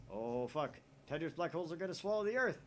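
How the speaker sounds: usual speaking voice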